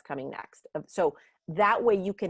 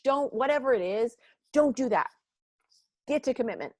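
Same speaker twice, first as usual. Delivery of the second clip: a higher-pitched, insistent boss lecturing the team; pushy boss; 5 semitones higher than usual. Retold or imitated. A leader ordering staff to skip the dip, insistent. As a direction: lay down the rules like a no-nonsense leader. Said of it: "leaders wanna say".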